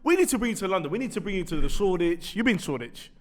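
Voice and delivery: normal talking voice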